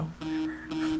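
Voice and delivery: soft, dismissive "Whatever"; soft shrugging whatever; breezy whatever. Retold or imitated. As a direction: shrug it off under the breath. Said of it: "Now I just go".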